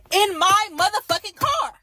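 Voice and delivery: high, loud indignant shout; exasperated yell, voice shooting up; outraged. Real, not acted. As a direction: punch out every word at full pitch. Outraged it was her car.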